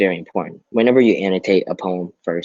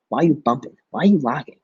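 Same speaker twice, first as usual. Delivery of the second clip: taunting lines; 8 semitones higher than usual. Shown as a demonstration. Expressive poem reading, high taunt.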